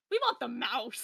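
a hint of a couple picking the mouse; mock adoptive parents. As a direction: announce the choice flatly, like parents at an orphanage. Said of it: "and it's like".

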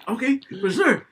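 high-pitched, guileless okay; innocent agreement. Retold or imitated. Passenger offered the car's actual speed, innocent. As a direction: agree like you suspect nothing. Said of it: "he said it in the most innocent way".

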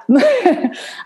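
high bright laugh burst; sheepish laugh; embarrassed laugh. Real, not acted. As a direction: laugh off the awkward moment. Laugh after intense story.

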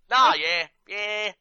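high, drawn-out Aussie "nah, yeah"; Australian "yeah, nah" with a lifted voice; Australian phrase demo. Shown as a demonstration. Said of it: "That kind of thing".

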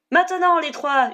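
high, loud shout; urgent full-voiced cry; yelled command. A narrator's reading. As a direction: yell the signal across a battle. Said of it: "hurla-t-elle".

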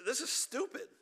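a hammed-up grumble at his own life; fed-up prodigal son. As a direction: act out a young man sick of feeding pigs. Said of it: "He says".

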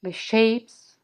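slow, soothing meditation guide; sultry narrator. Acted. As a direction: ease them into calm, slowly. Back to guiding the meditation.

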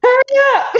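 high urgent hurry; mock impatience. Rushing buyer before Sunday, high mock urgency. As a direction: push them along, laughing.

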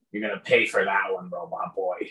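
lightly voiced menacing goblin; threatening goblin. Goblin's threat voiced by game master.

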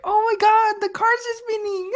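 put-on very high, squealing girly voice; mock excited girls. Mocking little girls at a street takeover, squealing excitedly. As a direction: mimic clueless girls shrieking in amazement. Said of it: "all the little girls that don't know".

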